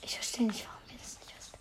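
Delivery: exasperated whisper. Real, not acted. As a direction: whisper it in disbelief.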